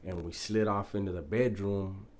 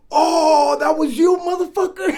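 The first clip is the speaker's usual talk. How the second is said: a much higher, louder cry cracking into laughter; laughing shout; 14 dB louder than usual. Retold or imitated. Quoting Bad Boy catching on, shouting through laughter. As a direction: yell it while breaking up.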